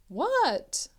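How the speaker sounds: ordinary delivery, nothing marked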